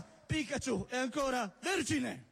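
usual speaking voice

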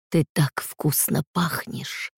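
slow, soft predatory growl. Acted. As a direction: purr it out like a hungry predator. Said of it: "прорычал он". (медленное тихое рычание)

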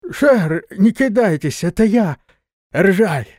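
high, loud calling out. A narrator's reading. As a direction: call out from the dark. Nomad calls out in dark.

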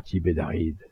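plain reading voice

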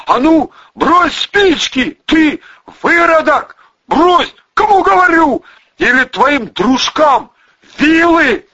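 high, loud, slow bellowed order; shouted threat. A narrator's reading. Hostage-taker orders gunman to drop matches, shouting. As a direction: yell the ultimatum at full force.

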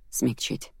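soft, subdued statement; understated reply, kept soft; quiet answer. A narrator's reading. Man explaining his offer to the angry heroine, quiet answer.

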